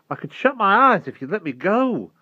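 high, loud bargaining protest; exasperated plea. A narrator's reading. Held boy bargains for release.

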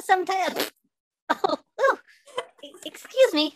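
usual speaking voice

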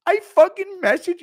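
high, loud quick shriek; mock scream. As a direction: yell it at a coffin. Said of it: "he just screaming at a coffin".

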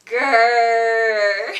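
very loud playful bear growl; big growl. Pretend. Showing kids how to grrr, very loud growl. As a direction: let out a huge growl for the kids. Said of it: "I want to hear you grrr".

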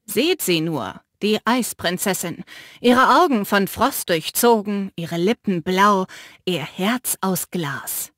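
lightly declaimed verse, slowing at the end; reciting a poem. Acted. Mick mocks the sister by reciting a poem, lightly declaimed. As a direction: recite it like a mock ode. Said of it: "als würde er ein Gedicht aufsagen".

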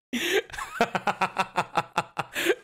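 high, rapid giggling cackle; helpless high-pitched laugh; laughing fit. Real, not acted. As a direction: crack up in a long run of laughter. Cracks up at own joke.

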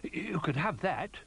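high, slow dog character voice; Ruff, helpful. A narrator's reading. Ruff offering his bone to friends, helpful. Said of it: "said Ruff helpfully".

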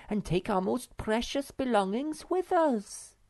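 teasing her fussy brother; chiding little sister. Acted. Snork Maiden voiced.

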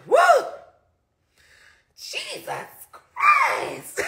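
very high, startled shout; shocked yelp. Retold or imitated. Her thumb suddenly pops, she shrieks in shock. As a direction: shriek as the thumb snaps free.

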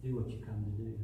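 low, quiet, gentle words to a betrayer; tender and soft. Acted. Jesus gently calls his betrayer friend, tender and quiet. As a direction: say it softly, with love, not sarcasm. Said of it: "the sound of his voice saying".

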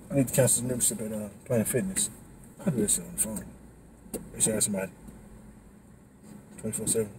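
quiet, winded talk; tired and breathless, low voice; out of breath. Real, not acted. Winded after boxing class.

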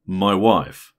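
mildly short reply from the lieutenant; a trifle short. A narrator's reading. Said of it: "answered a trifle shortly".

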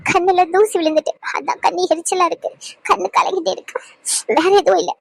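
holding back tears while denying them; tearful. Acted. Anu tells little Roshan nothing is wrong, tearful. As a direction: pass off the crying as dust in the eye. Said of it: "ஏன் அழுவுறிய".

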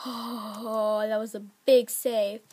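ordinary talk, nothing marked

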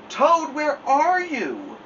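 high, slow drawn-out call. A narrator's reading. Frog searching the dark house, calling out. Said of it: "called Frog".

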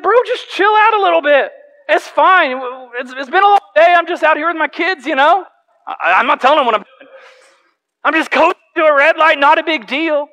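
smooth it over, easy-going; calming him down. Retold or imitated. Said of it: "And I said".

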